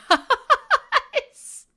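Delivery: high, loud word through laughter; helpless laughing finish. Real, not acted. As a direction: crack up on the last word. Cracks up finishing the sentence.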